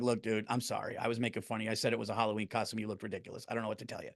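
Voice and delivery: sheepish apology. Pretend. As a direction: apologize quietly to the agent. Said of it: "I'm like".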